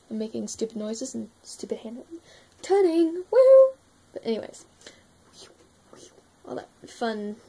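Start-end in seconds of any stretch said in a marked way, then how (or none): 3.3-3.7 high, loud woo; loud playful woo; howl. Shown as a demonstration. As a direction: let out a sharp high whoop. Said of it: "playful noise".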